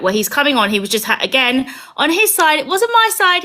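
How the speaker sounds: ordinary talk, nothing marked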